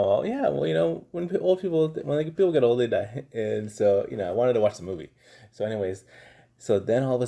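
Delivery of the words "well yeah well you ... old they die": higher and louder, flustered dad; awkward fumbling explanation. Retold or imitated. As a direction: stumble through an awkward answer, pitched up. Dad explains death to son.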